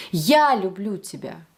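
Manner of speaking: "Я" punched first, "люблю тебя" falling away; strong, lifted opening "Я", then descend; stress on Я. Shown as a demonstration. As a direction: hit "Я": it's me. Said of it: "It's me, not him, not her".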